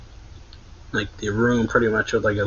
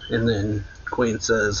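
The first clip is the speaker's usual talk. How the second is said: ordinary talk, nothing marked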